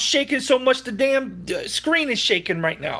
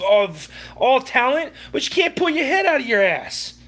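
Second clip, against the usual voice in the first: exasperated rant; 5 semitones higher than usual. Real, not acted. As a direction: pitch climbing higher, incredulous and heated. Furious rant at coach's words.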